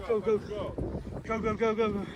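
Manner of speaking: fast, pressing go go go; urgent urging. Real, not acted. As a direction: hurry yourself on. Urging himself to the boat.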